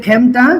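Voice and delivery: খেমটা with a wide ae in the first syllable; open e sound. Shown as a demonstration. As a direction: model খেমটা as "khaem-ta". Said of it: "এ ধ্বনি বিবৃত হবে".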